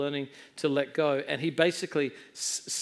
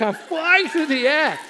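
high, loud mock alarm; hammed-up airborne shout; retold mid-air panic; 10 semitones higher, 6 dB louder than usual. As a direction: cry out as if flung through the air.

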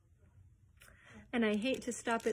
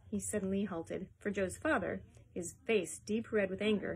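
usual speaking voice

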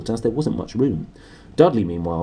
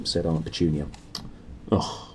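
usual speaking voice

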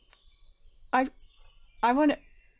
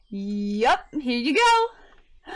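slow drawn-out offer; 4 dB louder, much slower than usual. Acted. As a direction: stretch out here you go. Handing over crown slowly.

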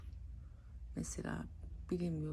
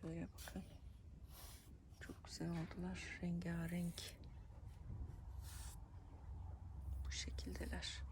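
whispering; 9 dB quieter than usual. Real, not acted. Shows plants up close.

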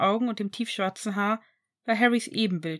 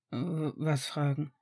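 quiet, slow stammered disbelief; irritated confusion; 6 semitones lower, 6 dB quieter, slower than usual. Acted. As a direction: stumble over it, thrown off balance. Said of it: "irritiert sah Severus".

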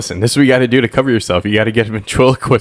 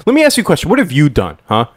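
accusing mayor; 8 semitones higher than usual. Pretend. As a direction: turn it back on them. Mayor turning question on reporters.

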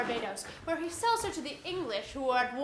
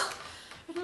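sharp breathless gasp; startled gasp; 3 semitones higher, 7 dB quieter than usual. Acted. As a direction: gasp in shock.

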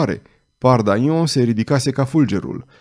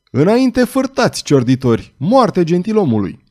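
high, loud rallying yell; shouting a war cry; 6 semitones higher, 4 dB louder than usual. A narrator's reading. The red-haired thug rallies his thieves against the nobleman, shouting. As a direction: whip the gang into a charge. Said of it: "strigă".

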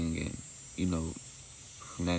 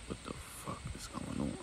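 confused whisper; 5 semitones lower, 10 dB quieter than usual. Retold or imitated. Boss confused, muttered aside.

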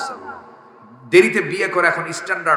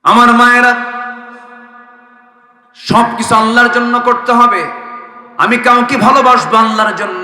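full-voice preaching; 9 semitones higher, 11 dB louder than usual. Acted. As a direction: preach it out loudly on a raised, sustained pitch. Preaches in a loud chant.

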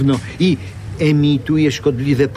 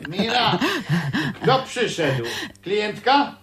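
calling from elsewhere; 7 semitones higher, 4 dB quieter than usual. Acted. Man calling from another room.

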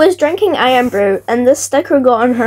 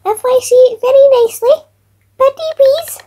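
high, sweet pleading little voice; cute begging voice; 13 semitones higher, 3 dB louder than usual. Acted. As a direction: beg sweetly like a small child.

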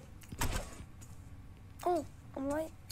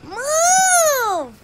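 high, louder mock yell; imitated yell; 11 semitones higher, 5 dB louder, much slower than usual. Mimicking Suleiman trying to leave the bus, high mock yell. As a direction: shriek it like a kid trapped. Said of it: "he's like".

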